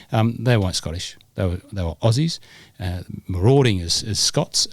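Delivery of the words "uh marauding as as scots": ordinary delivery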